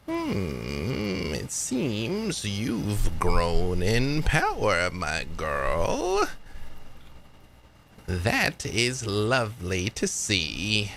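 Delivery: put-on slow, drawn-out old spirit woman; Granny. Granny greeting her girl who has grown in power, slow and knowing. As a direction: savour each word with swooping pitch. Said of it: "Granny's voice".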